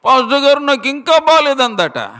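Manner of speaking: high, thin squeak of a woman who has lost her voice; squeaky voiceless woman. Retold or imitated. Woman with throat cancer begging the pastor, high strained squeak. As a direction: imitate a sick woman straining to speak. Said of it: "కీచు స్వరంతో అరుస్తూ ఉందట".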